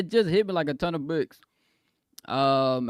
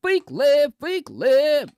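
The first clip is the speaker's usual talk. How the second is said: loud, high chant of fake live; loud call-out; 13 semitones higher, 9 dB louder, much slower than usual. Real, not acted. Streamer calling out chat for saying fake live, loud. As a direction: call out the viewers loudly.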